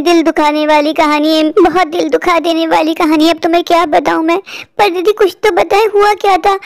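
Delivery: ordinary talk, nothing marked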